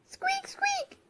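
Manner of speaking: tiny high whispered squeak; squeaky mouse. Acted. Missus Mouse peeping as she leaves her cover, high whispered squeak.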